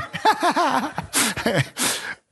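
high burst of laughter. Real, not acted. Preacher laughs at his own joke to the congregation, high. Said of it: "I'm not as forgiving as God is".

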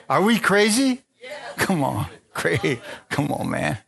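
ordinary talk, nothing marked

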